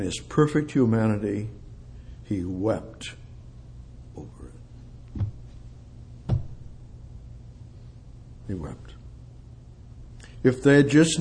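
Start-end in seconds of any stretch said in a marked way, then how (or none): 2.2-8.9 soft, breathy, slowed-down grief; hushed. Acted. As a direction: drop to a near whisper, slow and tender. Preacher softens on Jesus weeping.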